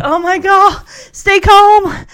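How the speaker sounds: loud, high retold panic; mock near-meltdown. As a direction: imitate a bride barely holding it together. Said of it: "two seconds away from just like".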